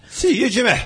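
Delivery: high, put-on Scottish accent for the greeting; a jokey Glaswegian farewell, Scottish lilt. Shown as a demonstration. Host mocks a Scottish accent on air, Scottish voice. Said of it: "such as Scottish accents".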